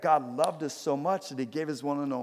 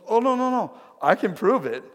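high, loud acted insistence; hammed-up urgent denial, high-pitched and emphatic; insistent protest; 8 semitones higher, 6 dB louder than usual. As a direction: burst out protesting, eager to prove it. Veteran insists he can prove it.

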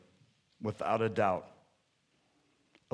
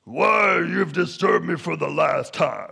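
angry villain voice, drawn-out first word; hammed-up menacing shout; Winter Warlock. Warlock confronting Kris Kringle, mock angry shout. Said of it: "and he's like".

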